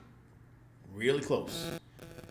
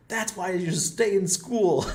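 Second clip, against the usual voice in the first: slow, loud, high mocking jab; parting taunt; 9 semitones higher, 7 dB louder, slower than usual. Driving away, taunting the guard, slow and loud. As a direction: shout the taunt out the car window. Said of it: "I'm pissed".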